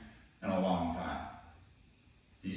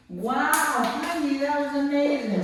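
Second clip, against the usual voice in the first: high, loud, slow amazed praise; 5 dB louder, slower than usual. Real, not acted. Trainer gushes over her dog after the release, high, loud and slow. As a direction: gush over the dog slowly.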